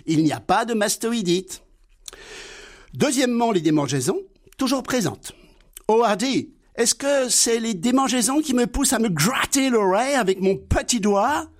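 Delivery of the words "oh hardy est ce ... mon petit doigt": higher, naive sidekick voice; Laurel's voice. Acted. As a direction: play the simple-minded friend, high and eager. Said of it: "Dialogue imaginaire entre Laurel et Hardy". (voix aiguë et naïve de Laurel)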